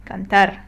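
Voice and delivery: cantar read with clear stress on TAR; last syllable stressed. Shown as a demonstration. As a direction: punch the final syllable of cantar. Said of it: "if I want to read it correctly".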